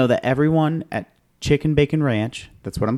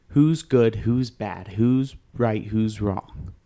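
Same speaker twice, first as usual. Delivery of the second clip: normal talking voice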